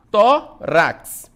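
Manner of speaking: "Tórax" split into Tó, rax with a gap; loud, separated beats on "Tórax"; syllable by syllable. Shown as a demonstration. Teacher doing the same with tórax, syllable by syllable. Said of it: "mesma coisa".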